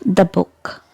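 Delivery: "the book" with "the" as short "thuh"; weak the before consonant. Shown as a demonstration. Teacher gives an example before a consonant, "thuh book". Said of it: "when it appears before a consonant".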